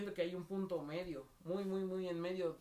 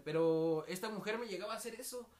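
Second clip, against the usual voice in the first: ordinary talk, nothing marked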